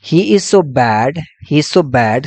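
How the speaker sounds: each word spaced out slowly for notes; measured dictation pace. Shown as a demonstration. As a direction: read it out slowly so students can copy it down. Teacher dictating an example sentence.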